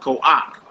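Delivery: "Koak" ending in an "ock" sound; "Koak" with the vowel before the final k; correct pronunciation. Shown as a demonstration. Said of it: "you pronounce that koak".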